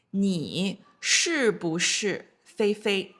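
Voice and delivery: 你是不是菲菲 said very slowly, every syllable clear; slow model question. Shown as a demonstration. Teacher first models the question to students, very slow. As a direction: drag out 你是不是菲菲 for learners. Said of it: "So, for example".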